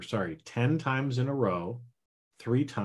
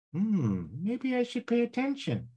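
high, thoughtful squeaky voice; the inner voice grudgingly coming round; subconscious voice; 11 semitones higher than usual. Acted. Subconscious finally listening, high and pondering. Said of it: "my subconscious voice".

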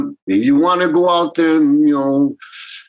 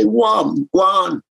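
higher, shouted "go on"; shooing; 6 semitones higher than usual. Retold or imitated. Telling a doubter to go away, a mock shout. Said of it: "you say".

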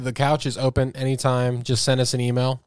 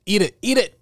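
excited; 8 semitones higher, 3 dB louder than usual. Real, not acted. Egging on repeated.

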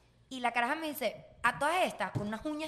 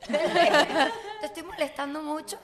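laughing, slow; 2 semitones higher, 4 dB louder, much slower than usual. Retold or imitated. Retold line, slow, laughing.

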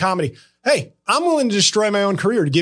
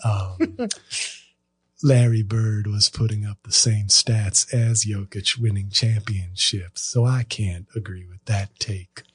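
much lower, softer mock Isaiah Thomas voice; Isaiah Thomas impression; 9 semitones lower, 4 dB quieter than usual. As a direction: drop deep and quiet, playing the rival pundit. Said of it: "You like that, Isaiah?".

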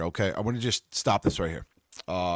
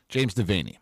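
usual speaking voice

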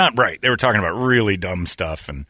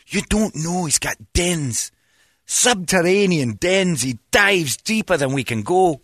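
high, drawn-out Scottish insistence; hammed-up Scots true believer; mock Scottish believer; 6 semitones higher, slower than usual. Believer insisting Nessie has dens, slow mock Scottish accent. Voices believer rebutting sonar argument.

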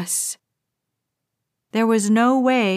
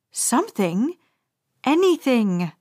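usual speaking voice

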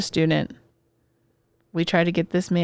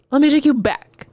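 louder, higher repeat with a punch; punchy storyteller's call-back; emphatic story lead-in; 5 semitones higher, 5 dB louder than usual. Shown as a demonstration. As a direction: announce the flashback, higher and louder.

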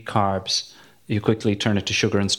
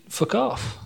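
dismissive; 12 semitones higher, 4 dB quieter than usual. Acted. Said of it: "and I just think".

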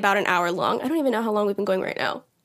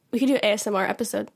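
usual speaking voice

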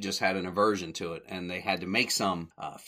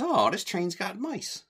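mock Curly; 12 semitones higher than usual. Curly quote, pitch jump.

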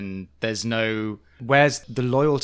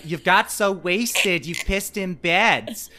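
higher, slow, drawn-out accusation; mock accusation; 7 semitones higher, much slower than usual. Co-host imitates Gina accusing Vicky, slow drawn-out jab. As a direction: drag out the telling-off. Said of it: "Gina's like".

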